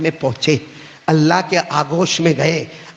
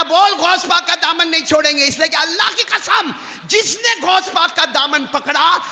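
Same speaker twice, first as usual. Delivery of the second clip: retold shout; 11 semitones higher than usual. Husband shouts at his wife.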